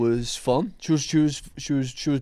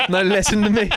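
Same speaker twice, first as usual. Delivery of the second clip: mock commander; 7 semitones higher, 4 dB louder than usual.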